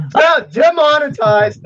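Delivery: high, loud, drawn-out mock alarm; mock outcry. Host reacting to a co-host's swearing, loud mock alarm. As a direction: cry it out in joking dismay, stretched and high.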